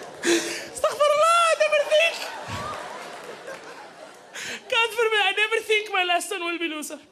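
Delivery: high, mocking immigrant mother. Mom despairs that her comedian son earns no money, high and scornful.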